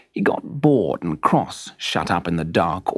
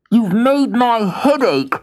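much higher, slow whiny toy voice; complaining Jack; 11 semitones higher, 4 dB louder, slower than usual. Acted. Jack complaining to the hammer, high and slow. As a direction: whine it out like a sore little toy. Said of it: "said Jack in the Box".

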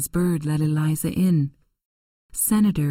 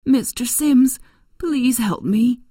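higher, louder, pleading call; desperate plea; 8 semitones higher, 4 dB louder, slower than usual. Acted. Eliza begging a family friend for help, higher and louder. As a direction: cry out for rescue. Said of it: "cried Eliza".

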